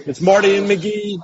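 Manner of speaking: punchy Hillbilly Headlines radio promo read. Acted. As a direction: sell the segment like a radio announcer.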